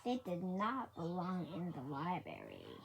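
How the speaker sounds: usual speaking voice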